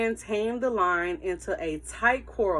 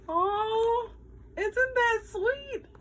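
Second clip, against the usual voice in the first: very high, slow sweet coo; much slower than usual. Real, not acted. Teacher to her class, cooing over the sweet ending. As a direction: melt over the happy ending.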